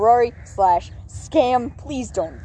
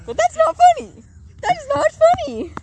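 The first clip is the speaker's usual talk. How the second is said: much higher, louder squeaky protest; 6 dB louder than usual. Acted. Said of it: "I'm doing the squeaky voice on purpose".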